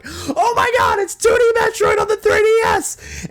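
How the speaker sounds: high, loud cracking shout of joy; reenacted scream. Fan reliving Metroid reveal, ecstatic scream. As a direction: scream it like you can't believe it. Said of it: "I'm like".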